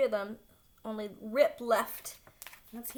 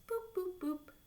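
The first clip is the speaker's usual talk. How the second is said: keypad beeps; 11 semitones higher, 3 dB quieter than usual. A narrator's reading. Keypad beep effect.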